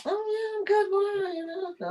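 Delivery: mock startled reply. Newcomer answering a stranger who called her duck, squeaky and high. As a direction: play the confused newcomer in a high, thin voice. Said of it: "the first time I was like".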